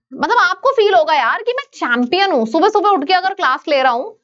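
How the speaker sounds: usual speaking voice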